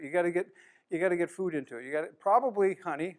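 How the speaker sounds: ordinary delivery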